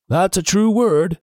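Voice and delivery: slow, high solemn pirate voice; mournful pirate confirming slowly. A narrator's reading. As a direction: say it like a grave oath. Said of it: "agreed solemnly".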